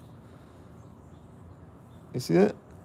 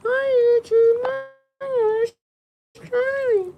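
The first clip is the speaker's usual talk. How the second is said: squeaky high-pitched dog voice, much higher; voicing the dog; 8 dB louder, much slower than usual. Owner speaking for his dog to YouTube in a tiny cartoon puppy voice. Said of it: "You wanna say hi to YouTube?".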